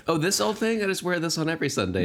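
high, breezy fake nonchalance; faux-casual show-off. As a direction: play it off like it's nothing. Faux-casual bragging quote.

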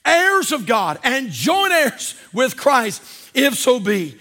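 loud, slow preaching of scripture; scripture reading. Acted. Preacher to congregation, loud solemn scripture reading. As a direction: proclaim the verses to the church. Preacher reads Romans aloud.

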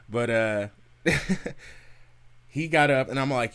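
usual speaking voice

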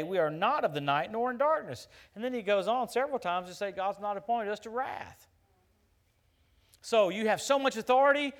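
loud, ringing preacher's declamation; loud pulpit voice driving each phrase home; full-force preaching. Acted. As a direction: preach it out to the whole hall.

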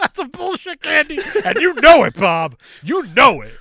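loud, high-pitched mock rant; mock outrage. Telling Bob his candy is bullshit, loud mock outrage. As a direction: hammer it at him in pretend fury.

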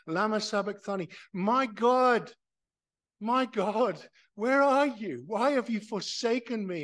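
loud, high anguished cry to God. Retold or imitated. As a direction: cry it out as if from the cross. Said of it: "And he cries out".

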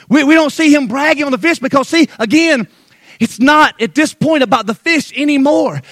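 loud, high, driving sermon delivery; full-force preaching. Acted. Preacher rousing the congregation about Peter's faith, loud and high. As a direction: preach it hard to the back row.

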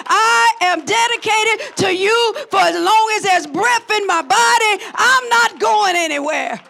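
full-throated, driving sermon delivery; impassioned preaching. Real, not acted. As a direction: preach it with rising fervor.

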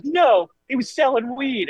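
high, slow scandalized grandma; grandmother's voice. Retold or imitated. Grandmother reveals the weed to grandfather, high and slow. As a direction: break it to him like a shocked grandma. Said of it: "she goes".

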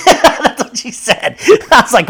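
breaking into real laughter mid-story; laughing. Real, not acted. Retelling a Twitter insult to viewers, laughing out loud.

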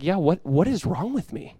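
soft, slow bewildered mutter; a stunned man asking himself quietly; Jonah dazed. Retold or imitated. Jonah at the story's end, softly bewildered. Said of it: "He's just like".

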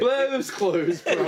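slow words through laughter; laughing. Real, not acted. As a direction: laugh while saying it, slowly. Laughing at the idea.

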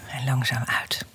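soft whispered breathing cue; whispering. Acted. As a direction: breathe the words out, hushed.

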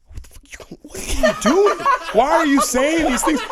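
high, loud, laughing disbelief; incredulous outburst. Retold or imitated. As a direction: stretch it out in hammed-up shock. Retelling shock at wife's first-name use.

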